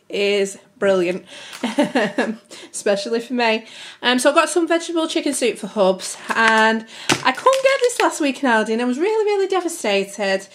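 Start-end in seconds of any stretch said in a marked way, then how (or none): none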